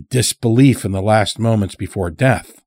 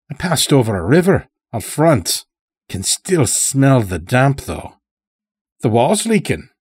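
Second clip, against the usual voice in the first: put-on higher-pitched rustic old-timer, chatty; lightly voiced old farmer; 5 semitones higher than usual. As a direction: lightly play a folksy captive making observations. Old captive farmer speaks in story.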